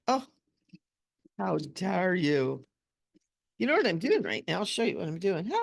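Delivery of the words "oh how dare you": slow, drawn-out pretend outrage; hammed-up how-dare-you, teasing; mock offended. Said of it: "I went".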